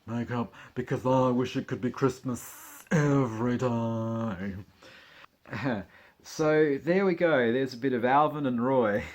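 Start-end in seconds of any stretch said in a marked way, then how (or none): 0.1-4.7 mock drag queen. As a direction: ham up a jealous drag queen, stretching the end. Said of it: "They're like".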